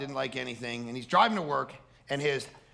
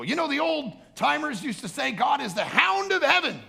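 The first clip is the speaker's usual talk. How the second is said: "God is the hound of heaven" much higher and louder, falling on heaven; preaching shout; 7 dB louder than usual. Retold or imitated. Preacher retelling his sermon line, loud pulpit shout. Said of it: "And I go".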